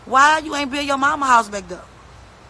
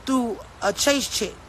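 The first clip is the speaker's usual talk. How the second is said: ordinary delivery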